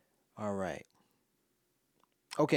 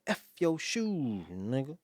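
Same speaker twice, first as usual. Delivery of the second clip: Dave Chappelle bit; 2 semitones higher, slower than usual. Retold or imitated. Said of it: "Dave Chappelle".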